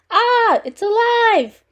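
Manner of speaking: high, loud, drawn-out cry of horror; terrified scream. A narrator's reading. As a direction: scream it like a horror-film victim. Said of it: "The man screamed".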